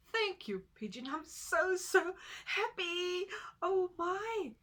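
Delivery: high, loud happy tortoise voice; so happy, high and bright little tortoise; Tutu the tortoise. Acted. Tutu relieved by news.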